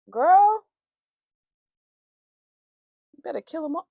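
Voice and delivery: put-on loud joking outburst, girl; hammed-up yell of advice; mock shout. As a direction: shout it like a dramatic friend. Imagined reply to mom.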